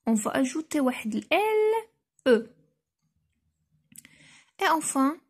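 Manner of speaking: ordinary delivery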